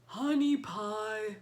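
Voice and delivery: loud, slow, drawn-out monster call; monster shouting. A narrator's reading. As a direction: bellow it like a lovesick giant. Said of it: "the monster shouted".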